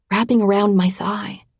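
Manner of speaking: plain reading voice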